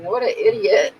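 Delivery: high amused laugh after the words. Real, not acted. Herself to Jess, amused laugh at her ex. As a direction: laugh at him.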